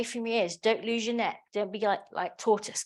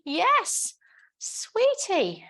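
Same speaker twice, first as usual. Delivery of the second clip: cooing to a pet; 9 semitones higher, much slower than usual. Real, not acted. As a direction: coo at the dog. Cooing over a dog.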